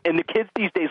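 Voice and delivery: usual speaking voice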